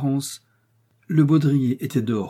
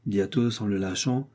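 ordinary delivery, nothing marked

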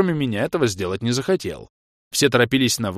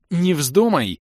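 warning cry; 6 semitones higher, slower than usual. A narrator's reading. Baroness stopping the elf.

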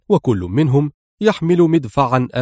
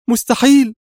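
high, loud outburst of refusal; vehement, indignant exclamation; sharp cry; 11 semitones higher, 6 dB louder than usual. A narrator's reading. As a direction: burst out sharply, pitched way up. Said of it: "هتفت في حدة".

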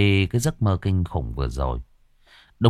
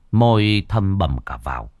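ordinary delivery, nothing marked

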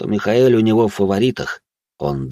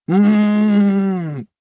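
long high closed-mouth moan; strained, drawn-out high hum; agonised groan; 13 semitones higher than usual. Acted. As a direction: groan through a splitting headache. Monster groans with headache.

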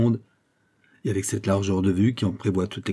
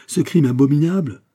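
loud, high outrage; growing exaltation; 6 semitones higher, 6 dB louder than usual. A narrator's reading. Fouquet denouncing the crime in his house, outraged. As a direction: cry out in mounting indignation. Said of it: "poursuivit Fouquet en s'exaltant de plus en plus".

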